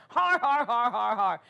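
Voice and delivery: retold guffaw, each har separate; imitated laughing out loud. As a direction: mimic a hearty, defiant laugh. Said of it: "And he laughed out loud".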